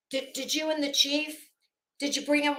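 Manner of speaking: usual speaking voice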